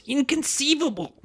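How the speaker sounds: high, squeaky Vizzini cry of disbelief; high, incredulous Wallace Shawn yelp; Wallace Shawn impression. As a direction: do Wallace Shawn's famous outburst. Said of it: "That was pretty good".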